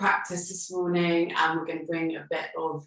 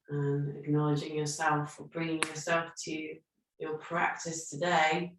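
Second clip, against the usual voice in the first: calm, unhurried meditation guidance; guided breathing meditation; 4 dB quieter than usual. Acted. Yoga teacher guiding her online class through breathing, calm and soothing.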